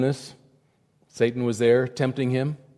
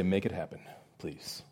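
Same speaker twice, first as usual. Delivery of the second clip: quiet plea; 8 semitones lower, 14 dB quieter than usual. Acted. Believer's quiet plea voiced.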